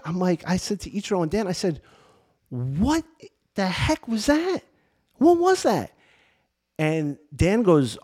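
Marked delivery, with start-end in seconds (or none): 2.5-5.9 high, astonished what the heck was that; asking in wide-eyed amazement; retelling his own amazed question to friends; stunned disbelief. Retold or imitated. Asking friends after strange experience.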